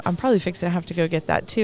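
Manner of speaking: fast, jittery rush of words; talking fast. Real, not acted. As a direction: rattle it off, over-caffeinated. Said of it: "I'm talking really fast".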